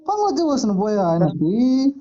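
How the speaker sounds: ordinary talk, nothing marked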